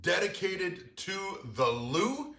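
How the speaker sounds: upbeat, slow host welcome back; show host intro. Acted. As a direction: warm TV host greeting, drawn out.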